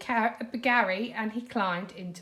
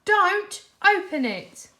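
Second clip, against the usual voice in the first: shouting after him; 10 semitones higher, 7 dB louder than usual. A narrator's reading. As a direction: call it after someone running off. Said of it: "SpongeBob shouted after him".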